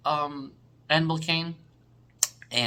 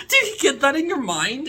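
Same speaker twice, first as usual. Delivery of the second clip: laughing; 13 semitones higher, 6 dB louder than usual. Real, not acted. Worked up, half crying.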